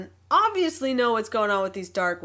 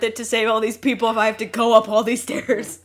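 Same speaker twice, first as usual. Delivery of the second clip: mock exasperated whine; 7 dB louder than usual. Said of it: "He's like".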